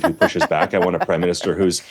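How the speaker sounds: normal talking voice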